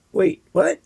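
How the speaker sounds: high, puzzled "Wait, what?"; startled double take; confused surprise. Retold or imitated. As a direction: sound caught off guard. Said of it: "that kind of like".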